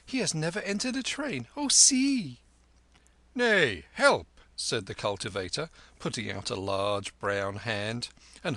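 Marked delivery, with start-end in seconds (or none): 0.0-2.4 high, giggly excited voice; a lightly voiced courtesan pointing and exclaiming; excited girl. Courtesan delighted at the lama's first train ride, high. 3.3-4.3 high, loud urging; urging help. Acted. Cultivator urging the lama aboard, loud and high.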